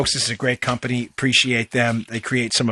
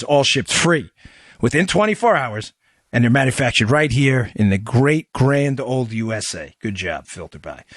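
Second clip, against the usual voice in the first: ordinary talk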